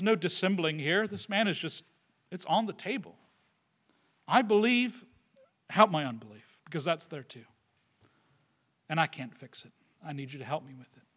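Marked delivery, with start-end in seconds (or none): 4.3-11.0 soft, low admission, sinking as it goes; quiet confession. Acted. Desperate father admits he can't fix it, quiet confession. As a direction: confess it softly, dropping lower and quieter.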